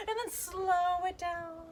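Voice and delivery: high, drawn-out SLOW; slowing right down. Pretend. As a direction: stretch it out in playful slow motion. Voice mimics slowing hops.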